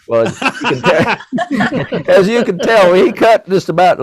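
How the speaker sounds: laughing. Real, not acted. Laughing about his bad haircut.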